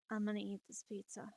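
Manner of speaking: very soft stoner mumble; stoned. Retold or imitated. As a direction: murmur it as if stoned. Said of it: "Dude, you sound stoned".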